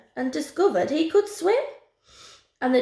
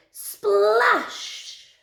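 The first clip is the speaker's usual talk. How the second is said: big drawn-out splash; 10 semitones higher than usual. A narrator's reading. Story sound word acted out.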